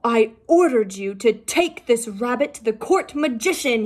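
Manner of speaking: loud angry king's roar. Acted. King roaring at the turtle, loud and angry. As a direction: bellow the order at them. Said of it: "he roared".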